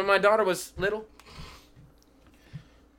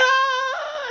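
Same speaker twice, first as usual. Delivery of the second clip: imitated child wail; 4 dB louder than usual. Imitating daughter's cry.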